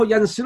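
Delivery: ينسر pronounced "yansiru"; kasra on the middle letter. Shown as a demonstration. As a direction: give ينسر an i vowel, yan-si-ru. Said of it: "ما ورد". (نطق ينسر بكسر العين: يَنْصِرُ)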